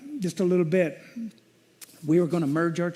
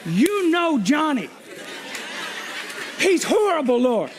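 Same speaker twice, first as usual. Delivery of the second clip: mock ranting to God; 7 semitones higher than usual. Pastor retelling his rant to the Lord, worked-up high complaint. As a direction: throw a friend under the bus, voice up high.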